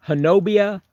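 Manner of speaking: Honobia as huh-NO-bee-uh; spelling pronunciation. Shown as a demonstration. Streamer telling viewers to look up Honobia, said as spelled. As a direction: sound out Honobia with stress on NO. Spelled form given for a search.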